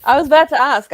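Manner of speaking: loud excited blurt. Real, not acted. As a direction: jump in eagerly. Excited interjection.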